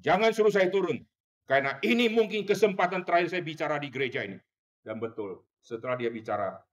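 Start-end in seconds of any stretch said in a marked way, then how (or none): 0.0-4.4 loud, higher, fast angry snap at his wife; angry retort. Retold or imitated. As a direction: refuse her sharply. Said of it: "dia marahin istrinya". (suami membentak istrinya dengan marah)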